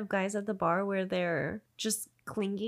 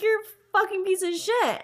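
high, loud mock fury; retold angry insult; 11 semitones higher, 5 dB louder than usual. Woman insults groper before walking off, retold fury. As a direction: hurl the insult in a raised pitch.

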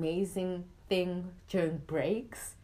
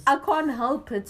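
higher, louder protesting retort; mock-exasperated outburst, pitched up; retold protest; 7 semitones higher, 8 dB louder than usual. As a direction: throw it back loudly in self-defence. Acted retort to complaining classmates.

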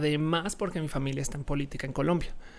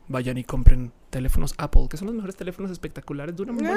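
mock ad pitch; 7 dB louder than usual. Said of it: "Necesitan alguien que diga".

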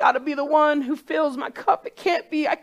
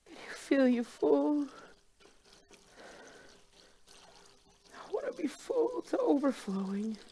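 quiet; 9 dB quieter than usual. Acted. Quiet intimate moment with cup.

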